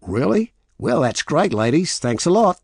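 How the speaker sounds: high, loud, cheery Aussie thanks; strong Australian accent. Acted. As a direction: thank them like an effusive Australian tourist. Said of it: "he said in a strong Australian accent".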